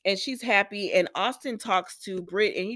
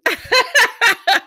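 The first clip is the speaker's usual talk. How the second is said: real burst of loud, high laughter; 11 dB louder than usual. Real, not acted. Recapper amused by a favorite duo, loud laughing.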